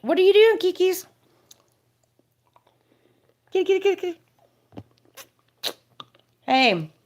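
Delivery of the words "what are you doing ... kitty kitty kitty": high cooing pet voice. Real, not acted. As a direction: coo at the cat up high. Talking to her cat.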